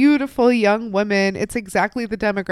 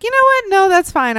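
mock polite refusal; 11 semitones higher, 6 dB louder, faster than usual. Woman politely backing out of request.

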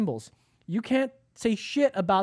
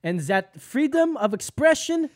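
put-on German accent; 3 semitones higher, 4 dB louder than usual. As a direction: read it like a stiff German official. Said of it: "You need to read it in a German accent".

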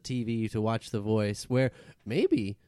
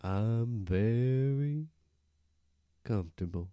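very slow, drawn-out tortoise; talking tortoise; 4 dB quieter, slower than usual. Acted. Tortoise answering from the back seat, slow and easy. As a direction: take each word at a tortoise's crawl.

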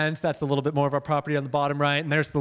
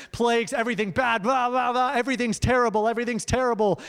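high-pitched doom-news parody; mock alarm; 7 semitones higher than usual. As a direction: rattle off the bad news in mock panic. Mimicking relentless bad news barrage.

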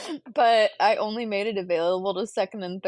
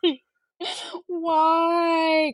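laugh-crying whine; 7 semitones higher, much slower than usual. Real, not acted. Laughing-crying over rough morning.

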